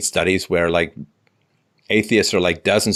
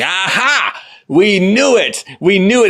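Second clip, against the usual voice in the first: much higher, louder mock crowing; gloating triumph; 11 semitones higher, 6 dB louder, slower than usual. Leftists gloating at the blowback, mock triumphant. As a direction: crow it like you've been proven right. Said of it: "some people on the left are gonna be like".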